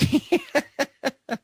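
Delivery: shrill high burst of laughter; a real amused cackle. Real, not acted. Laughing at story.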